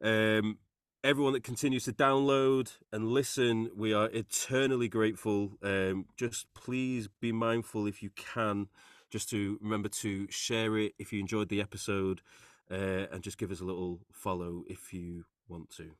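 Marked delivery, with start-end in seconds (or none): 5.6-15.9 trailing off into an awkward, softer plug; reluctant sign-off. Real, not acted. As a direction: mumble the plea half-heartedly. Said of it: "I'm very good at this".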